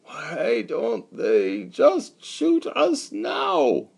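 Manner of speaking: very slow, high drawn-out moan; a dying man's hopeless plea. Acted. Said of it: "Meyer Katz was moaning".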